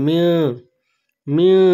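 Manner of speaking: মিউ held long through the nose; nasal ghunna. Shown as a demonstration. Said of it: "নাকে টেনে পড়তে হবে". (নাকে টেনে গুন্নাহ সহ)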